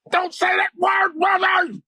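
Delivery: high, slow demon screech; demonic voice. Retold or imitated. Possessed friend cuts in on his plea, demonic screech. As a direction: cry it out like a possessed man, drawn out. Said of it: "This demonic voice arose out of him".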